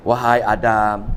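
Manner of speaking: deep, slow, sly devil voice; a low, drawn-out call from the devil; Iblis tempting. Acted. Preacher voicing Iblis luring Adam, deep sly tempter. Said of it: "Tengok iblis ni".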